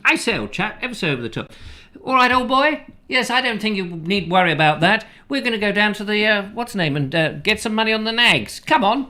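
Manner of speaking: put-on bright, cheerful old-film Englishman, pitched high. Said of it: "your bright, cheerful Englishman".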